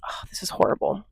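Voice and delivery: unvoiced whispered aside. Real, not acted. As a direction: whisper it to yourself, dismayed. Phone overheating, aside.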